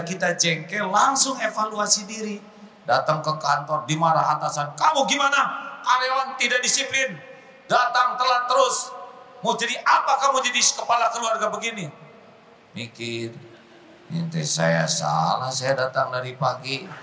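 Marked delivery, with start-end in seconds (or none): 4.7-11.9 scolding boss. Acted. Atasan memarahi karyawan. 14.1-16.9 grumbling employee. Acted. Karyawan membela diri.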